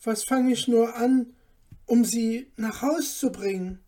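troubled. A narrator's reading. Son at a loss over his mother, troubled. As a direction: wonder anxiously what to do. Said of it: "sprach der Kleine bekümmert zu sich".

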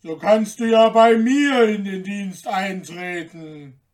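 loud giant voice; big, heavy-voiced giant offer. A narrator's reading. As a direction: boom it out like a giant. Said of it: "sagte der Riese".

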